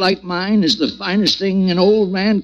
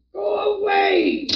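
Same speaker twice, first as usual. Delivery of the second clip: high, slow, sobbing cry of terror; anguished crying shout; 14 semitones higher, 3 dB quieter, slower than usual. Acted. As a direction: cry it out in panic, drawn out. Old man crying out in sleep.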